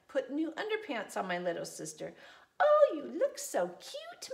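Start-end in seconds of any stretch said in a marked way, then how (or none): 2.6-4.3 high, doting mother voice; Mom's cooing voice. Acted. Mom praising sister in new underpants, cooing. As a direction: coo over the baby. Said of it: "Mom said".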